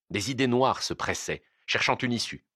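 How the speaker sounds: ordinary delivery, nothing marked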